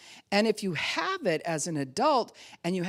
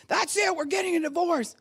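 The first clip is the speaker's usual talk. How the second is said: mock angry outburst; 14 semitones higher, 4 dB louder than usual. Couple mock fight.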